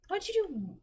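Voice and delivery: high, curious coworker voice pitched well up; lightly mimicked coworker asking questions; imitating a coworker. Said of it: "And they're like".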